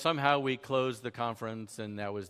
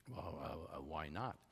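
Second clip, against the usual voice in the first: much lower and softer, a tired, put-upon moderator; weary moderator; 9 semitones lower, 12 dB quieter than usual. Retold or imitated. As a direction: sigh out the question like a tired host. Moderator's weary reply again.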